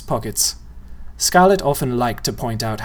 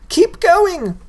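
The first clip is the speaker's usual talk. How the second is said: put-on much higher, loud girl's shout; 5 dB louder than usual. A narrator's reading. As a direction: urge him on while running. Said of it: "Scarlet shouted".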